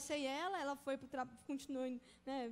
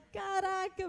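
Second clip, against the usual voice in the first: louder, higher mock shock; stunned reaction; 4 semitones higher, 7 dB louder, much slower than usual. Student put on the spot, acted shock. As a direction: blurt it out, acting caught off guard. Acts out own shock at question.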